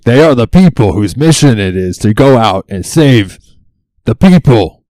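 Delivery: loud, high, emphatic protest; indignant outburst. Real, not acted. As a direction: hammer it out, exasperated. Indignant rant about rescuers' mission.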